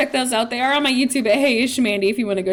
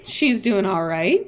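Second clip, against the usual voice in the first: ordinary talk, nothing marked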